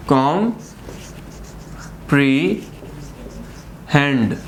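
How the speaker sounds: Comprehend very slowly as कॉम, pre, hand; syllable by syllable. Shown as a demonstration. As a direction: leave long silences between the three parts of comprehend. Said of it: "तीन हमारे पास sections बने".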